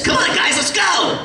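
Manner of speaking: Popeye on helium, very high and shouted. Shown as a demonstration. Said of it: "something like this".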